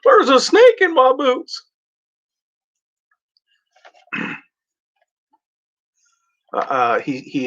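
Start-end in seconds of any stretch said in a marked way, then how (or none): 0.0-1.7 Woody voice. Retold or imitated. Quoting Woody from Toy Story.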